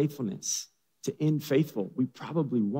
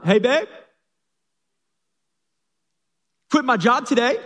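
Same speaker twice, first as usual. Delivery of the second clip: higher, louder, eager husband announcing news; excited Noah; 10 semitones higher, 6 dB louder than usual. Acted. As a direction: blurt it out like proud news. Pastor voices Noah to wife.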